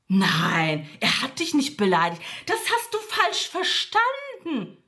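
Freundin nachäffen, am Ende viel höher und langsam; spöttisch die Freundin spielen, die abwiegelt; nachgeäffte Freundin. Retold or imitated. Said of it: "Und wisst ihr, was sie sagt?". (die Freundin leicht nachgemacht, hoch und gedehnt)

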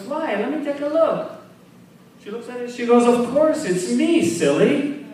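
put-on high, bright ditzy girl voice; girly voice. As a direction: squeal it like a clueless girlfriend. Blonde girlfriend looks in mirror.

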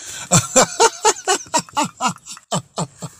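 squeaky high laugh cracking up; high giggle. Real, not acted. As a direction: let a high giggle burst out. Laughing at his own skit.

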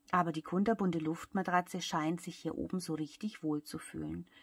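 usual speaking voice

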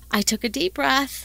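ordinary delivery

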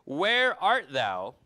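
long, drawn-out loud call. Acted. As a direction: call out slowly and loudly to someone hidden. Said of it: "the Lord called unto Adam".

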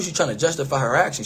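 ordinary talk, nothing marked

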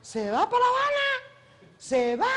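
high, slow mock call after a runner. Actress retelling a crew member calling after her, in a high mock call. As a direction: call out as if she's running away. Rafael calling out as she runs.

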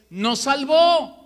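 slow, loud and high, leaning on "salvó"; emphatic quote. Acted. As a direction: stretch out "Nos salvó" and hammer "salvó". Repeating Paul's key words. (cita enfática, lenta y fuerte)

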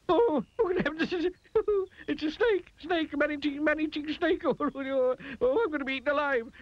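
very high gibbering, ending in a wail; terrified of being eaten; hysterical panic. A narrator's reading. Panics at seeing a worm.

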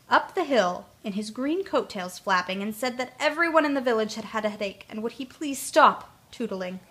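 plain reading voice

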